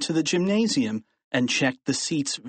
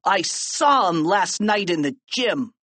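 put-on higher tattletale kid voice; put-on snitching pupil; Melvin Sneedly; 6 semitones higher than usual. A narrator's reading. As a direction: tell on them eagerly. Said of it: "Melvin said, pointing".

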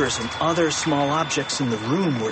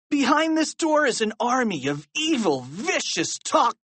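put-on high, pleading boy's warning; alarmed, high-pitched pleading; urgent warning; 11 semitones higher than usual. A narrator's reading. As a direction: warn desperately until cut off. George desperately warns the principal.

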